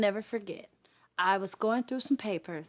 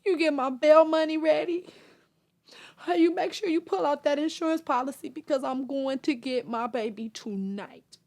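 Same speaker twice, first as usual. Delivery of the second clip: retold determined orders; 7 semitones higher, 6 dB louder than usual. Mother instructs parents before rescue.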